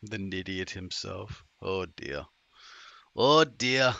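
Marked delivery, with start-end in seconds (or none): none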